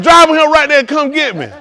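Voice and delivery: high loud laughing grandma; mock grandma. Imitating grandma giving orders, high and laughing. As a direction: toss it off laughing. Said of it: "Be like".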